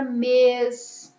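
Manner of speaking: mês drawn out slowly with a closed E; closed vowel. Shown as a demonstration. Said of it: "Quando o som é mais fechado". (mês com som fechado e alongado)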